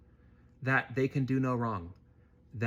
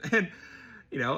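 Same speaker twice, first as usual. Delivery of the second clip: usual speaking voice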